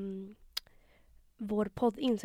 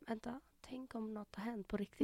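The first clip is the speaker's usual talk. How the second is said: whispered, hushed sudden worry; worried whisper; 14 dB quieter than usual. Retold or imitated. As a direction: whisper it as the fear dawns. (viskande, orolig)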